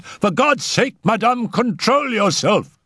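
high, urgent pleading; alarmed cry. A narrator's reading. Raoul begs the mother for control, really alarmed. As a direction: cry out with pitch leaping up. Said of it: "He was really alarmed now".

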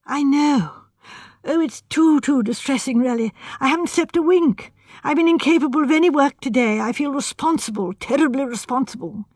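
put-on anxious, overwrought young architect; nervous young man. Voices distressed young architect.

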